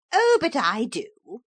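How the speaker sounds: high, bright-toned insistence; a smug intruder contradicting Philip; Pretenderette voice. A narrator's reading. Pretenderette to Philip, smug and high.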